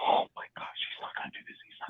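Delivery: breathless hushed whisper; tense whisper. Real, not acted. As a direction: whisper it in suspense.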